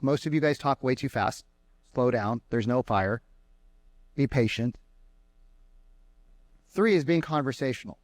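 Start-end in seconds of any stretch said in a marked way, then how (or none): none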